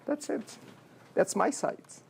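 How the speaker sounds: ordinary talk, nothing marked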